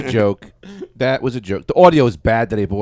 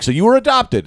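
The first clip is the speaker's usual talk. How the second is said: incredulous; 10 semitones higher than usual. Retold or imitated. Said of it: "And I'm like".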